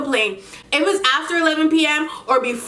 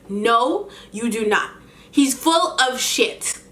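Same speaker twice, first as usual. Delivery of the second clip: ordinary talk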